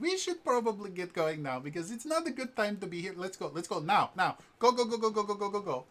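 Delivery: put-on high, quick, urgent girlish voice; frantic high girl voice chanting go; hurried Jester voice. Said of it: "I was like".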